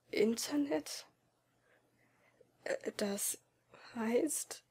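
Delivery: whispered, trailing off in dread; quiet whisper. A narrator's reading. Boy realizing he loses contact, whispering. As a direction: whisper it, not wanting the answer. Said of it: "fragte ich leise".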